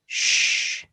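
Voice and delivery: long unvoiced "shh"; hushing shh. Shown as a demonstration. Teacher shows French C H as a hushing girl, shh. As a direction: hush with finger to lips. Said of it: "finger to the lips".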